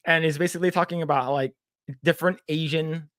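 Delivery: ordinary delivery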